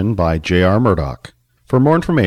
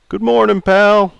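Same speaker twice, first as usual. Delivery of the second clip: put-on raised, jovial old customer; 11 semitones higher, 5 dB louder than usual. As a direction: play Charlie, teasing. Charlie greets back.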